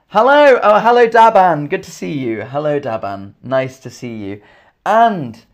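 ordinary delivery

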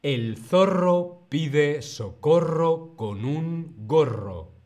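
slow, loud tongue twister with "zorro" drawn out; slow model reading. Shown as a demonstration. Teacher models the tongue twister for learners, slow and loud. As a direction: model it slowly and firmly for repeating. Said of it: "Primero lo digo yo y luego lo repites tú".

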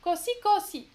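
Così said as CO-sì, weight on the first O; Così with the accent on the first syllable; stress on the O. Shown as a demonstration. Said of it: "j'ai refait sur le O".

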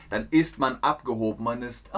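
ordinary talk

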